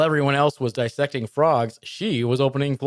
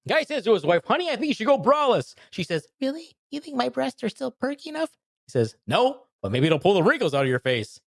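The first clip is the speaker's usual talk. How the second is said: much higher joke read; silly high voice; 12 semitones higher than usual. Pretend. Retelling the braless joke in a squeaky high voice.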